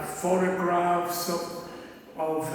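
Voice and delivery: usual speaking voice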